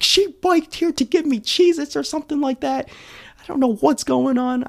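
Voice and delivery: very high, squeaky imitation; whiny little tattletale, hammed up; mock snitching. Imitates friends snitching on each other.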